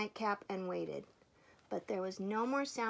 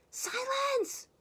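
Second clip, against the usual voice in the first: very high, loud outburst; roar; 5 dB louder than usual. A narrator's reading. Bear loses patience with whispering Mouse, roaring. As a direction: explode in a demand for silence. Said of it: "Bear roared".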